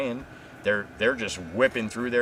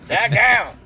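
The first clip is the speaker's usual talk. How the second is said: loud, high-pitched catchphrase impression; Jay; 12 semitones higher, 8 dB louder than usual. Host showing the one impression he can do, loud catchphrase yell. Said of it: "I can do Jay".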